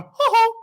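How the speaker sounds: high, loud "hoo-hoo"; wild whoop. Retold or imitated. Telling how his mind went, a high wild whoop.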